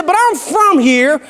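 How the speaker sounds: hammed-up high East Texan cowboy; East Texan accent. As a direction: welcome them as a high-pitched East Texan. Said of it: "in this rich East Texan accent".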